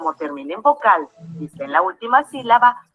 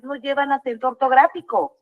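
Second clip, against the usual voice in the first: normal talking voice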